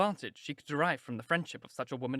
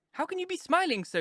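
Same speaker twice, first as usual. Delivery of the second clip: reproachful; 3 dB louder than usual. A narrator's reading. Sisters reproach Elizabeth.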